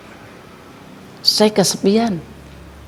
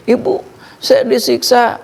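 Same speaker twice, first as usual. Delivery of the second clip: put-on child pleading; 8 semitones higher, slower than usual. Dead child cries to mother.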